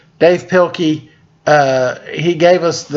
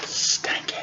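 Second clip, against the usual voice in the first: breathy whispered "stinky"; 12 dB quieter than usual. Pretend. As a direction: whisper it like a secret. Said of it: "whispered aside to listeners".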